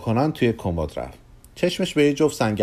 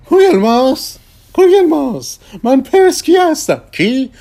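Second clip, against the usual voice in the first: much higher, louder showman's voice; grand magician; 14 semitones higher, 8 dB louder than usual. Acted. Persky calling Kugelmass, loud and high. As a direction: announce yourself like a grand conjurer.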